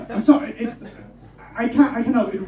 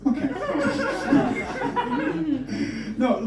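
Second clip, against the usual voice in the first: usual speaking voice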